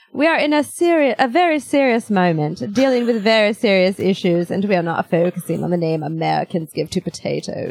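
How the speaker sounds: put-on French accent sliding into German; snotty mixed French-German accent, falling in pitch toward the end; mixed European accent. French embassy representative answering the press, in a muddled French-German accent. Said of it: "It turned a little bit German at the end".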